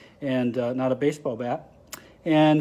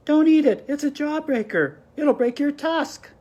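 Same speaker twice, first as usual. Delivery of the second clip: very high, louder urgent mouse warning; a tiny mouse begging his friend to stop; squeaky mouse voice; 11 semitones higher, 4 dB louder than usual. Acted. Mister Mouse warning Ganesha, high squeaky plea. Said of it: "squeaked Mister Mouse".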